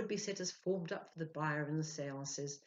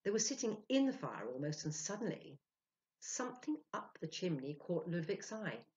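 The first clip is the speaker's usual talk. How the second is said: ordinary delivery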